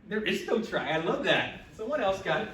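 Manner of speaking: ordinary talk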